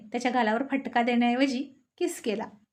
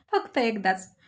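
laughing reply. Acted. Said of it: "Arjun फार हसून म्हणाला".

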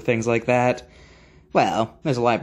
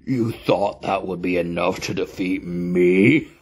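understated menacing villain with cackles; villain taunt; 4 semitones lower than usual. Acted. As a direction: gloat over the mummy with a low evil chuckle. Flaming skeleton taunts the mummy.

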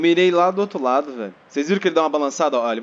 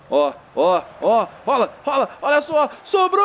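rising excitement; 5 semitones higher than usual. Real, not acted. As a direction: build the tension as the ball comes loose. Chance building up. (empolgação crescente)

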